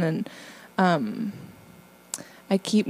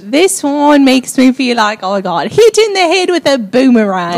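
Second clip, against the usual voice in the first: broad Aussie voice, a bit louder; Australian accent; 3 semitones higher, 7 dB louder than usual. Acted. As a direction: play an Australian tradesman boasting. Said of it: "So endeth the Australian impression".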